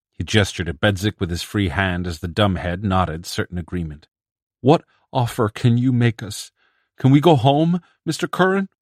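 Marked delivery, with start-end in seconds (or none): 4.6-8.7 pleading. Acted. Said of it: "a whimpering and a pleading in Sam Swope's voice".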